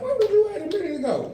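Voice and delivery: high, fast, laughing; squeaky quick accusation; mock accusing. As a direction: snap the question up high. Mock asking kids where they were.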